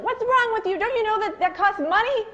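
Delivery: high, loud scolding outburst; angry yell. Retold or imitated. Parent scolding child over milk, a high angry yell. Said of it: "you feel like yelling".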